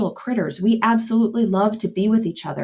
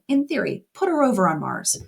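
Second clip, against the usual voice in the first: ordinary talk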